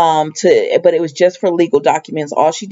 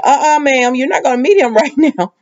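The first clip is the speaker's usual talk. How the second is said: sassy refusal; 6 semitones higher than usual. Retold or imitated. Refusing her with sass.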